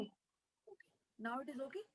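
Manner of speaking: very faint, far from the mic; almost inaudible, distant voice; barely audible. Real, not acted. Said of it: "but it's low".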